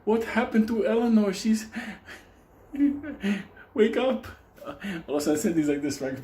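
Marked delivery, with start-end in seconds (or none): 0.0-4.3 hammed-up shock, breaking into a laugh; mock horrified onlookers. People outside seeing stabbed Eleanor, mock alarm. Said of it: "people outside like".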